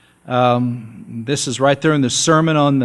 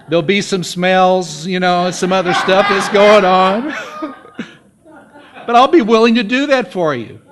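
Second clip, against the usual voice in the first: chuckling through a joking offer; laughing; 5 semitones higher, 6 dB louder than usual. Real, not acted. Preacher jokes to church about fake theater, laughing. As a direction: laugh it out, teasing the congregation.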